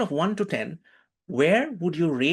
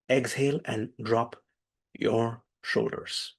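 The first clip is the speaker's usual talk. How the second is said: calm guided meditation; 3 semitones lower than usual. Acted. Teacher closing the breathing exercise, slow and calm. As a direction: ease them into stillness, slowly.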